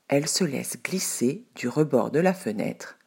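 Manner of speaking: usual speaking voice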